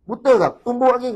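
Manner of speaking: loud, high brash threat; mock rude shout at an old man. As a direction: bark it like a cocky young thug.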